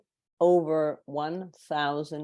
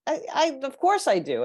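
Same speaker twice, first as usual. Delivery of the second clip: much higher, mildly annoyed protest; a little annoyed; 12 semitones higher, 4 dB louder than usual. Retold or imitated. Client protesting to Lisa, a touch annoyed and higher. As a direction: bristle at being doubted. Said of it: "getting a little annoyed".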